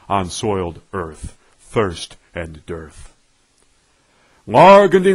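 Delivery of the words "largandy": high, loud declaiming cry of the name. Acted. Reciting poet crying out the name, loud and high. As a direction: call it out high and ringing.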